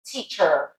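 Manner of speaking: American teacher with a strong final R; American accent. Shown as a demonstration. Teacher contrasting teacher with British, American accent. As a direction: lean on the "er" of teacher, American style. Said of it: "With an American English accent".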